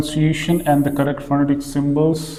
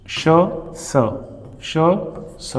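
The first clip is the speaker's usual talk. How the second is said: usual speaking voice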